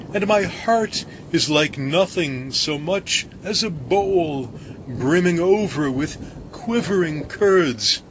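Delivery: mild fearful foreboding; baker's dread. A narrator's reading. Baker confessing his dread to crew, mildly anxious.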